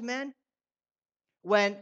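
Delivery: ordinary talk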